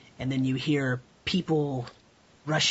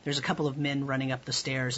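ordinary delivery

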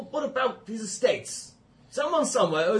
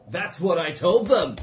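ordinary delivery

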